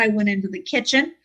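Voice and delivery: ordinary delivery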